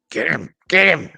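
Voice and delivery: excited high-pitched goading; cheering him on. Acted. Cheering Jake on from back.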